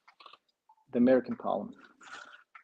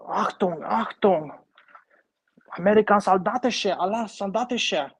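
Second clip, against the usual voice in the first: high, loud hammed-up German accent barking orders; mock German soldier; 8 semitones higher, 5 dB louder than usual. Playing with toy tanks, mock German soldier shouting. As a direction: ham up a cartoon German officer shouting. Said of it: "I'm trying to think of a German voice".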